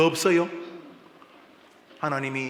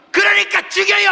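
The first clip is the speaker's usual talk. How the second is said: high, loud bratty shout at dad; defiant child yell; 9 dB louder than usual. Acted. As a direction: scream it back at your father. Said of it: "철수, 네가". (아빠에게 대드는 아이, 높고 크게 소리침)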